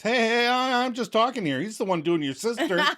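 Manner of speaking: high, hasty backpedal; mock panic. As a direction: talk your way out in a fluster. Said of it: "And [name] panics".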